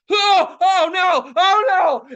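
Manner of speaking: slow, high, loud wails; acted panic. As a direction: wail as the fumes hit you. Reenacts breathing in the fumes.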